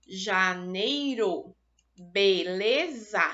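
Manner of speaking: "janeiro, beleza" said slowly as ja-nei-ro, be-le-za; split into syllables. Shown as a demonstration. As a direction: stretch each syllable of "janeiro" and "beleza" apart. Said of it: "Eu fiz a minha separação silábica".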